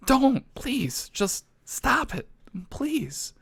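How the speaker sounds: high, small begging voice; weak, high-pitched mock despair; pleading. Begging people to stop.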